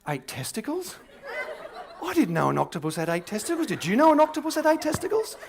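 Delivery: high-pitched mock disbelief; incredulous pupil, rising into high yelps; astonished schoolboys. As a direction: squeal it as a baffled back-row boy. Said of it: "all the boys in the back row going".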